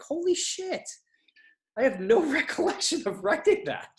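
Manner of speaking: very high-pitched, laughing astonishment; amazed disbelief. Retold or imitated. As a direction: act out being stunned by your own work. Retold astonishment at own writing.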